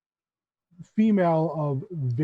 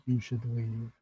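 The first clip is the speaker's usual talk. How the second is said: soft, lower warning from a stranger; hushed warning; 4 semitones lower, 7 dB quieter than usual. Acted. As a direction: lean in and warn softly. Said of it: "she leans back down and she says".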